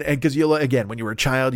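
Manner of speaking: ordinary delivery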